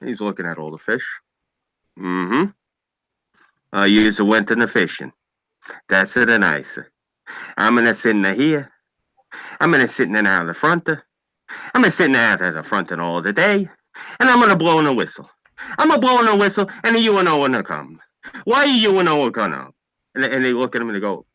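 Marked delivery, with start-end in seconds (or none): 2.0-13.7 put-on old Italian immigrant accent, measured phrases; old Italian father. Italian father answering his sons, heavy Italian accent. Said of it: "the old man looks at him and goes like this". 14.2-19.7 put-on higher, worked-up Italian immigrant accent; old Italian father. Italian father scolding his absent sons, worked-up Italian accent. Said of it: "the old man looks at him and goes like this".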